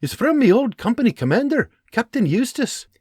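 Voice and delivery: lightly voiced excited soldier; eager Scottish sergeant. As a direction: rush through the good news. John reads letter.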